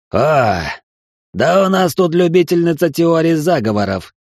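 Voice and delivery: high, loud mocking snort; scoffing. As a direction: scoff at her loudly. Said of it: "фыркнул хмырь".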